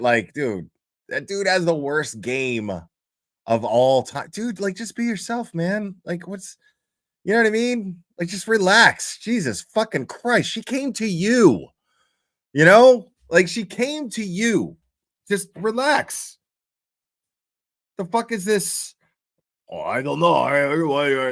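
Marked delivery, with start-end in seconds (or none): 4.3-18.9 high-pitched, incredulous scolding; exasperated. Pretend. Exasperated advice to an absent man. 19.7-21.3 lightly mocked frumpy, dithering voice; frumpy mumbler. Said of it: "his frumpiness in text".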